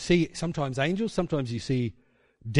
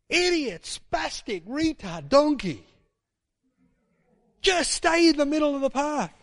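high, yelling insults at the donkey; furious insults; 12 semitones higher, 4 dB louder than usual. Retold or imitated. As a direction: lash out at the donkey. Balaam beats his donkey again.

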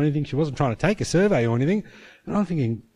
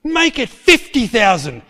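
higher, louder, slow and emphatic; angry order; 9 semitones higher, 6 dB louder, slower than usual. Retold or imitated. Angry principal ordering the accountant, slow loud command. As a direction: slam the figure down in anger. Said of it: "he was so angry".

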